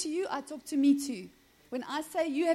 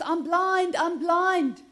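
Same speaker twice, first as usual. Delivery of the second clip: louder, higher mock cry for attention; 5 semitones higher, 5 dB louder, much slower than usual. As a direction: call it out like a blind beggar. Mock example of crying out.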